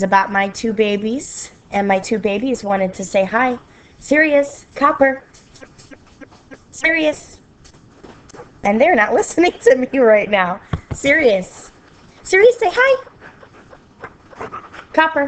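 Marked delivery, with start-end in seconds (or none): 4.0-7.4 calling the dogs. Real, not acted. Calling her dogs by name. 10.9-15.3 calling the dogs. Real, not acted. Coaxing dogs to say hi.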